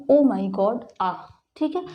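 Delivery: ordinary talk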